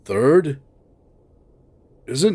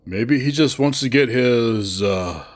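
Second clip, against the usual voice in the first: normal talking voice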